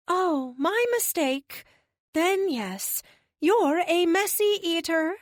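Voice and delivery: very high, slow, guileless voice; innocent alien. Acted. Alien correcting herself to Tony, high and guileless. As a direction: concede it slowly in a high, innocent voice.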